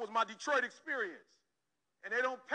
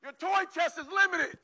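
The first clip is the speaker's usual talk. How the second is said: higher, louder and slow, each word landed; slow forceful declaration; emphatic loud; 7 semitones higher, 6 dB louder than usual. Real, not acted. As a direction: hammer the point home. Hammering the point to students.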